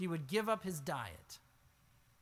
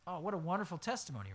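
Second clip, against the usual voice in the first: ordinary delivery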